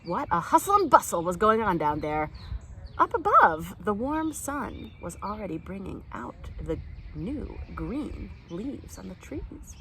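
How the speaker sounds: plain reading voice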